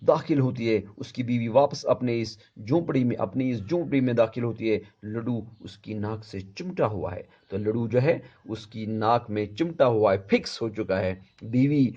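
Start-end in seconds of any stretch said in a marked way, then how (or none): none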